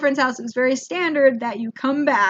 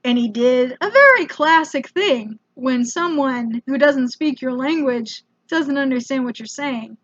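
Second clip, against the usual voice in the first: usual speaking voice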